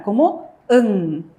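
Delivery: en said slowly ending in a back ng; model reading. Shown as a demonstration. Teacher models the syllable for learners, slow. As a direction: slowly sound out en with ng. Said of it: "¿cómo va a sonar?".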